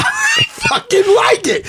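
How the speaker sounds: wired on espresso, yell it out; hyped up. Real, not acted. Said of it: "The espresso's kicking in".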